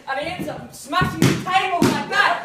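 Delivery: loud, high, fast-building account; telling the punchline part with rising glee; excited retelling. Real, not acted. Comedian retelling dad slamming table, laughing and loud.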